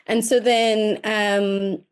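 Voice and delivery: ordinary delivery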